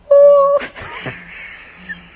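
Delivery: a loud, high burst of laughter. Real, not acted. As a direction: let out a big, high whoop of a laugh.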